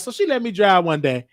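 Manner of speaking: ordinary delivery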